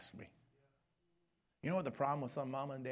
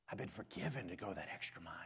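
whisper; 5 semitones lower, 6 dB quieter than usual. Acted. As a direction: let the words drop to a whisper.

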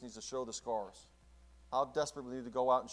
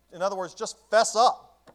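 slow, higher, louder call to fess up; fervent preaching; 7 semitones higher, 12 dB louder than usual. Shown as a demonstration. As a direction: plead it out, slowly and loud. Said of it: "repeated louder".

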